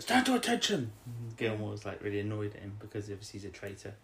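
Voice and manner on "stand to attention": high, loud mock sergeant command; hammed-up army command; barking order. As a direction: snap the order like a drill sergeant. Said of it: "and then he's like".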